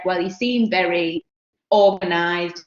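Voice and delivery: normal talking voice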